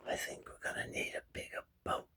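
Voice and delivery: whispered movie line, barely audible; Jaws impression. Man playing the whole cast of Jaws, whispered impression. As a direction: whisper it like a hushed film quote. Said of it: "I can play 'em all".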